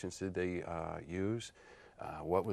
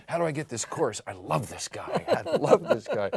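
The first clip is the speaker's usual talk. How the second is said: excited fan imitation, building to a high, laughing finish; mock fan enthusiasm; 7 semitones higher, 5 dB louder than usual. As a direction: gush like a won-over customer, cracking up. Imitates enthusiastic responses to spoof course.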